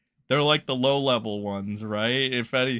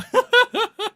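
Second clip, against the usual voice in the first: high burst of laughter; 9 dB louder than usual. Real, not acted. Laughing at his own joke.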